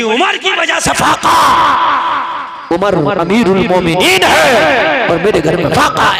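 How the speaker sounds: put-on loud, shouted accusing old woman; angry accusation. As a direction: hurl the blame at the caliph. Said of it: "वो बोली". (गुस्से में इल्ज़ाम)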